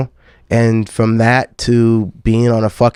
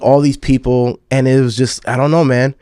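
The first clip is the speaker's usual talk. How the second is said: ordinary talk, nothing marked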